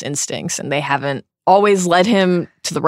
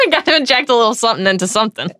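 fast, through laughter; laughing; 4 semitones higher, much faster than usual. Real, not acted. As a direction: laugh while saying it. Repeats joke with laughter.

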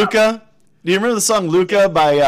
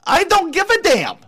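Minnesota accent, pitch riding high; northern Minnesota accent; 4 semitones higher than usual. Shown as a demonstration. Famous movie lines redone in a northern Minnesota accent. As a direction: Rhett and Casablanca lines in a sing-song Minnesota accent. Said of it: "with a northern Minnesota accent".

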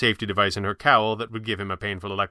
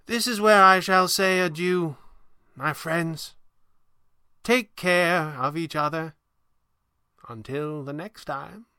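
high, slow, theatrical goodbye; grand old magician taking leave; old showman's farewell; 7 semitones higher, slower than usual. Acted. Voices Falcone's farewell.

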